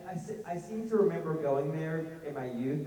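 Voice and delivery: usual speaking voice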